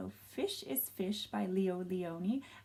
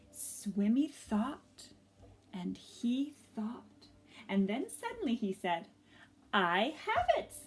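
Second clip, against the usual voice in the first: gentle storybook narration with lively swells; reading to children; slower than usual. A narrator's reading. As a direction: tell it like a picture book read to a class.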